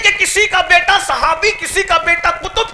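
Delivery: high, loud, building sermon cry; fiery preaching. Acted. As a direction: preach it to the rafters, rising each line.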